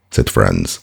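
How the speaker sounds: ordinary delivery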